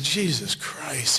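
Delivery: slow, drawn-out "Jesus Christ"; exasperated sigh. Retold or imitated. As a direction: give in wearily to a stubborn friend. Exasperated Affleck quote, slow.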